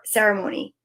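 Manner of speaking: usual speaking voice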